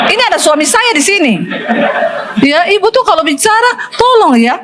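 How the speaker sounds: laughing quote. Retold or imitated. Woman in congregation quoted, laughing.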